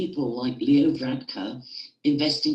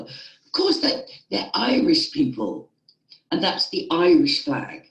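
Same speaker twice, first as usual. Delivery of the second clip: passionate; 5 semitones higher, 4 dB louder than usual. Real, not acted. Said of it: "getting a bit passionate there".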